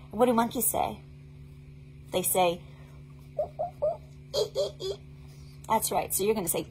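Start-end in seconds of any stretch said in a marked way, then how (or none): 3.4-4.9 much higher monkey ooh ee; high playful monkey calls; monkey hoots. Acted. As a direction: hoot like a monkey. Imitating monkey for children.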